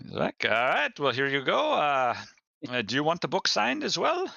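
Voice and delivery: high, eager merchant voice. Acted. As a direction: pitch it up like a sly trader.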